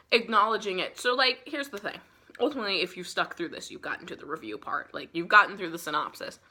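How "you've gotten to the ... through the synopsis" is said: ordinary talk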